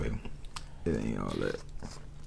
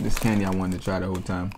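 ordinary talk, nothing marked

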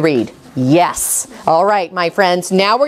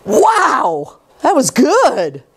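excited praise; 4 semitones higher, much slower than usual. Real, not acted. Teacher praises student reading.

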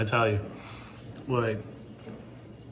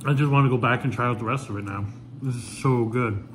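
talking while chewing; words muffled by food; mouth full of food. Real, not acted. Seen 0: Bites sandwich at -3; cheeks and lips show chewing while speaking.

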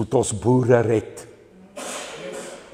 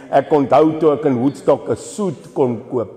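usual speaking voice

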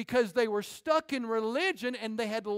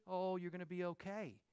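mock coddling; 3 semitones lower, 11 dB quieter than usual. Soft enabling reassurance mocked.

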